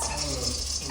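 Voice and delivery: a breathy whispered squeak. Retold or imitated. The rat's squeak, whispered. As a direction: hiss a little squeak.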